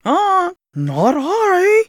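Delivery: slow, high honking goose. A narrator's reading. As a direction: drag it out like a silly goose. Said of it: "said the goose".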